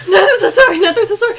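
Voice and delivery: high, loud, hurried apology; panicked apology. Acted. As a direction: blurt a frantic repeated apology. Character apologises for scaring them.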